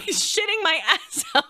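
words broken up by real laughter; giggling, barely getting it out; laughing through words. Real, not acted. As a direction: crack up mid-sentence. Cracking up at own story.

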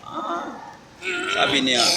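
usual speaking voice

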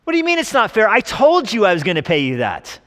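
high, loud mock rebuke; indignant landowner. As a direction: push back at the complainers, high and loud. Acted landowner rebukes complaining workers.

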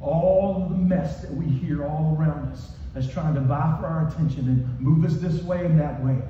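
ordinary delivery

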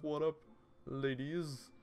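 smooth greeting. Acted. Imagined visitor atop the lighthouse greeting ladies, show-off. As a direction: call it out high and playfully cocky. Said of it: "you're like".

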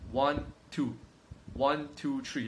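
slow, even, deliberate count; steady honest counting of seconds. Shown as a demonstration. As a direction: count each number slowly like a real rep. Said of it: "It's like".

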